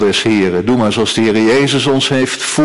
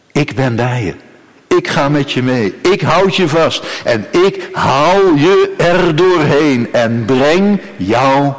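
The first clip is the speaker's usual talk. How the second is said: rising, deliberate reassurance; emphatic promise; 3 semitones higher, slower than usual. Acted. God promises Israel to carry them through, building and deliberate. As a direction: build word by word, slowing right down.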